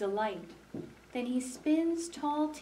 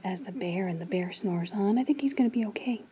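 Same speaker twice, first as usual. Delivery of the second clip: relieved whisper; 2 semitones lower, 4 dB louder, faster than usual. Real, not acted. Whispering after bear leaves.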